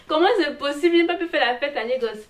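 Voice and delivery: loud, high-pitched theatrical outcry; hammed-up dismay at missing out; mock disbelief. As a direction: wail it in playful indignation, pitch leaping up. Mock outrage at missing Lagos parties.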